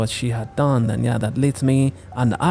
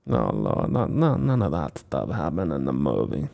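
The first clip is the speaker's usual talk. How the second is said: low despairing; 11 semitones lower, 6 dB quieter than usual. Real, not acted. Despairing commentary.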